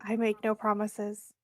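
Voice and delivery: usual speaking voice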